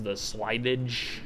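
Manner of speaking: "slidage" drawn out slowly as a question; slow doubtful slidage. Shown as a demonstration. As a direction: stretch "slidage" slowly, unsure of the word. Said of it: "Is, is that what they call it?".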